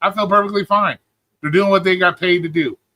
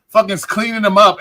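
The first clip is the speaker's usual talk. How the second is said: ordinary talk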